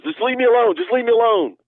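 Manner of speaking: high, whiny mock plea; scared pleading. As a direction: imitate a frightened friend begging.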